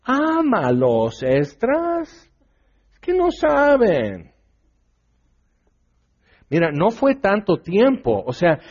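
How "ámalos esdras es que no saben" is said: mock the gentle advisers, sugary and coaxing. Someone urging Ezra to love the sinners, in a mock coaxing voice. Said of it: "y dices".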